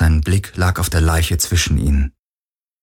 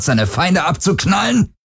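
mocking shout; 10 semitones higher than usual. Heska taunts gunman.

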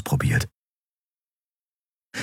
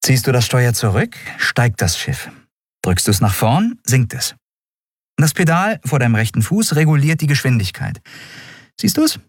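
raised dialogue voice, step-by-step instruction; lightly voiced instructing captain; 7 semitones higher than usual. Captain teaches the boy to steer, lightly voiced instruction. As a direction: explain the controls patiently, point by point.

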